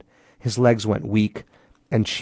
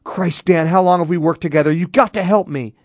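high, urgent pleading; raised, pressured appeal; desperate plea; 8 semitones higher, 4 dB louder than usual. A narrator's reading. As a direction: beg a friend for help, urgently. Desperate plea in a heated standoff.

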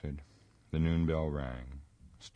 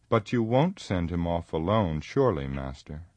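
eager outburst, louder; crying out; 3 semitones higher, 9 dB louder than usual. A narrator's reading. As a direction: blurt it out, pleading. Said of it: "cried Sam".

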